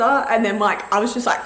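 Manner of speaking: normal talking voice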